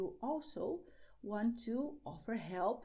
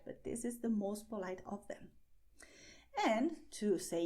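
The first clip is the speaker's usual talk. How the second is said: ordinary delivery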